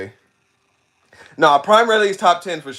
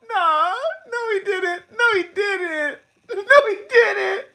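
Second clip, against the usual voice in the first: very high, wheezing through laughter; high-pitched disbelief, laughing hard; crying with laughter; slower than usual. Real, not acted. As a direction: squeal it out, slowly, helpless with laughter. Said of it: "I'm crying".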